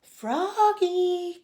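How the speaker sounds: high, loud drawn-out call. A narrator's reading. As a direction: call the name out long and high, like a dad summoning his kid. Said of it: "called his dad".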